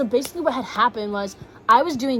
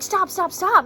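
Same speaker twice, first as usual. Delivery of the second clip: very high, loud frantic begging through tears; desperate pleading; 10 semitones higher, 4 dB louder than usual. Retold or imitated. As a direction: cry stop over and over. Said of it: "I'm crying and bawling".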